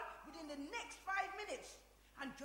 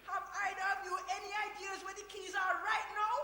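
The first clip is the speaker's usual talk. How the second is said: loud demanding question; exasperated, louder; 4 semitones higher, 8 dB louder than usual. Acted. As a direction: press them hard, losing patience. Dorothy interrogating about lost keys.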